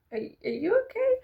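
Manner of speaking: a light concerned voice; hint of concerned husband. Husband patting her, lightly concerned. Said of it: "patting me, like".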